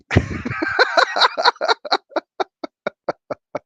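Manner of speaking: high, shaky laugh through happy tears; crying laugh. Real, not acted. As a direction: laugh while tearing up.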